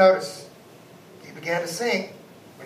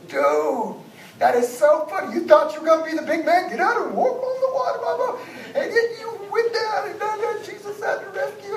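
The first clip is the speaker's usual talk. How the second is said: high, loud ribbing in a jeering buddy voice; mocking disciples; 12 semitones higher, 6 dB louder than usual. Laughing disciples ribbing Peter for sinking, high mocking jeers. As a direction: tease your friend who just sank. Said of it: "those other disciples started laughing".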